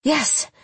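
high gasped yes. A narrator's reading. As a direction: gasp it out, high. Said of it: "I gasped".

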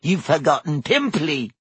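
spiteful little taunt; sly, put-on poltergeist voice; hissed. A narrator's reading. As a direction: needle her with malicious glee. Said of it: "Peeves hissed in her ear".

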